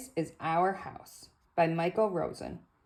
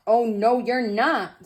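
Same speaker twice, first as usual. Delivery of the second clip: higher, louder no; loud refusal; 5 semitones higher, 8 dB louder, slower than usual. Acted. George refusing the doctor at the door, loud no. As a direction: bark the refusal.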